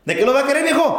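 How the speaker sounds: high, sing-song Sinaloa taco vendor; mock the Sinaloa vendor, pitched well up; Sinaloa accent. Taquero asking the trucker what he wants, in a high Sinaloa accent. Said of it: "forma de hablar, cantada, pero golpeada".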